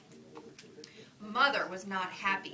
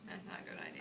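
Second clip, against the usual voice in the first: unvoiced whisper, much lower; whispered aside; 10 dB quieter, faster than usual. Real, not acted. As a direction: breathe it out as a warning.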